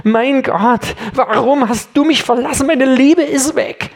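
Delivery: rising, pleading lament from the cross; anguished cry. Acted. Preacher voicing Jesus crying out on the cross, anguished. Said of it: "Er schreit am Kreuz".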